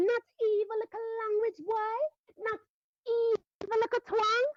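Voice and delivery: high, fast Jamaican scolding, building. Shown as a demonstration. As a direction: chide the boy in Jamaican patois, rising to a scold. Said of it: "So le-, it goes like this".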